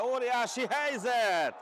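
loud, drawn-out call of a chance; excited hungarian sports commentary. Acted. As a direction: stretch it out in excitement. Big chance called live.

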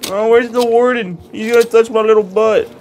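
high, loud frightened whine; mock fear. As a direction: play up dread of the warden.

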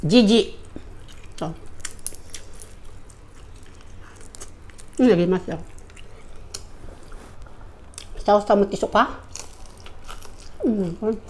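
talking while chewing; muffled chewing speech; mouth full of fish. Real, not acted. Seen 1: Face clear; chewing fish with closed lips between bites, continuous eating.

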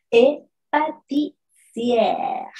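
et pâtissière slow, open è in sière; French pâtissière. Shown as a demonstration. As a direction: model pâtissière slowly. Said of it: "van a sonar como una E".

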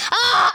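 high, loud shriek of alarm; sharp frightened cry; startled scream. Real, not acted. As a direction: let out a sudden scream as you slip. Startled shriek during fall.

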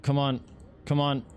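rising urgent come-ons; urging. Real, not acted. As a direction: coax it harder each time. Urging the generator on.

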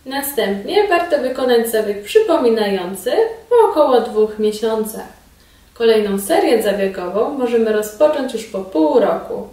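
ordinary delivery

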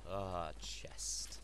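ordinary delivery

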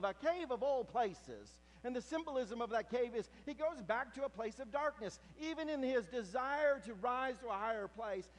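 high, loud preaching build; full-force preaching. Acted. Preacher telling the congregation of Elijah's cave, loudly. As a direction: drive the story home to the congregation.

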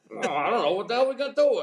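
put-on Southern accent shooting up high in a frantic yelp; panicked Southern accent. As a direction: play a rattled Carolina golfer in a Southern accent. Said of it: "he's got this Southern accent".

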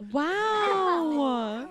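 usual speaking voice

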